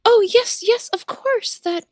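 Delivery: sweet character voice. Acted. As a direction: play it sweet and earnest.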